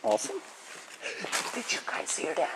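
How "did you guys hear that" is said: ordinary talk, nothing marked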